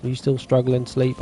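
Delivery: usual speaking voice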